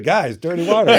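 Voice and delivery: high, loud mock surrender; calling to bandmates. Musician gives in and turns to his band, loud mock call.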